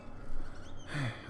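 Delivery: soft, breath-only sigh; resigned sigh. Acted. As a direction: let out a quiet acted sigh. Shopkeeper reacts to another letter.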